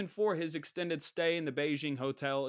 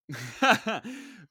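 loud laugh; 7 semitones higher, 8 dB louder than usual. Real, not acted. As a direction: burst of high, loud laughter. Laughs after admitting he is gambling.

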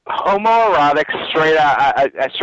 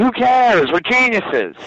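mock retort; 6 semitones higher, slower than usual. Retold defiant retort to haters.

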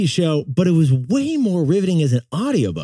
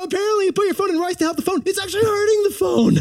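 excited; 11 semitones higher, much faster than usual. Real, not acted. Excited fast rice revelation.

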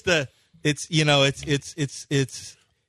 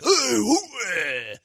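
high swoop into a catchphrase; sudden hammed-up movie-star holler that falls away; Al Pacino; 5 semitones higher than usual. Actor showing his on-screen character, swooping theatrical hooah. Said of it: "I was like".

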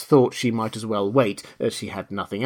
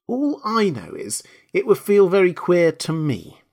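insistent Alice; 6 semitones higher than usual. A narrator's reading.

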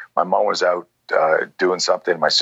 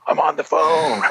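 put-on annoyed teen; 10 semitones higher than usual. Annoyed teen brother retold.

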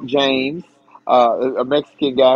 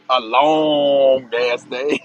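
slow drawn-out repeat; 3 semitones lower, much slower than usual. Acted. Repeated slower for effect.

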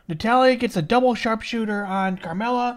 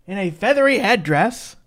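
ordinary talk, nothing marked

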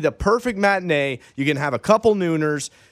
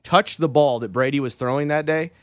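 ordinary talk

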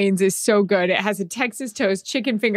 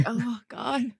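mock dread; 3 semitones higher, 10 dB quieter than usual. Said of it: "It's like".

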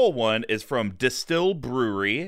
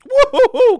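high loud woo-hoo; excited whoop; 13 dB louder than usual. Real, not acted. Reacting to strong stout taste.

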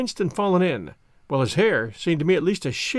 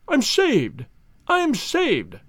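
much higher, rising relief; joyful cry; 7 semitones higher, much slower than usual. Acted. Banker clutches gems, joyful cry. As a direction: cry out saved. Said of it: "With a shriek of joy".

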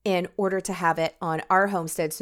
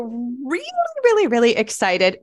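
falsetto on stressed words; 5 semitones higher, 4 dB louder than usual. Real, not acted. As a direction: voice goes high with excitement. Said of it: "My voice goes high when I get excited".

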